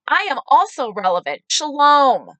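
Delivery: loud, high, entitled mock greeting; hammed-up self-important announcement; self-important Karen. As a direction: imitate a Karen butting in.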